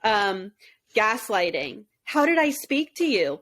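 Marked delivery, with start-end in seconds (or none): 2.0-3.4 mock innocent question pitched up high; faux-innocent "How did I speak to you?"; playing dumb. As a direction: ask it like you have no idea. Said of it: "Playing dumb".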